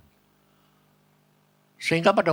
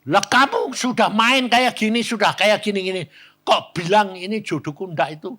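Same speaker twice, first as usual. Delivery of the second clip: high, louder, incredulous telling-off; scolding; 7 semitones higher, 5 dB louder than usual. Retold or imitated. Pastor to a couple already sleeping together, scolding. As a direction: scold them in a raised, high voice.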